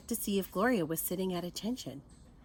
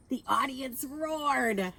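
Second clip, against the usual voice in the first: usual speaking voice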